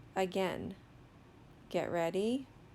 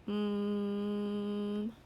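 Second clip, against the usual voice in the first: steady, monotone "mmmm" held long; sustained "mm" hum. Shown as a demonstration. Teacher models the sounds on the page, sustained "mm". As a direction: hum the M on one even note. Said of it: "tell me all of the sounds".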